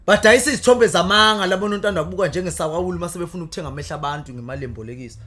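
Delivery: loud declaimed praise poetry; poem recitation. Acted.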